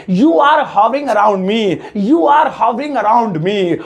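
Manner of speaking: slow, careful model English; slow clear teacher dictation; teaching model sentence. Shown as a demonstration. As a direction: spell it out slowly for the class. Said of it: "सुनो इसे कैसे बोलेंगे".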